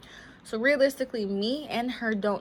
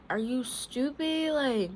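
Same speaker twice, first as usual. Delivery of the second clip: slow, higher mock-angry jab; mad retort; 5 semitones higher, slower than usual. Girlfriend snapping at her boyfriend, mock-mad. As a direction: scoff it at him like he's dumb. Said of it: "I was just mad".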